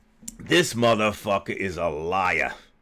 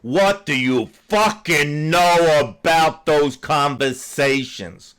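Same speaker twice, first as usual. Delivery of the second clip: each word pressed out slow and hard; angrily demanding a straight answer; slow angry demand; 3 semitones higher, 4 dB louder, slower than usual. Real, not acted. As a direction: repeat the question, slow and furious. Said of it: "Simple fucking question".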